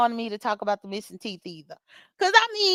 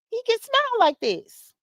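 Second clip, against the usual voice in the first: much higher, grinning delivery of "He can smile like this"; big showy smile; 10 semitones higher than usual. Shown as a demonstration. Showing Lemon his new dentist smile, high and grinning. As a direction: say "He can smile like this" high through a wide grin.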